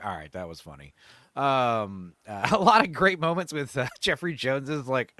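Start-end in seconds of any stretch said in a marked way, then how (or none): 0.0-0.9 much softer and lower, a reluctant mutter; grudging admission. Retold or imitated. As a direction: concede it under your breath. Begrudging viewer's reaction quoted.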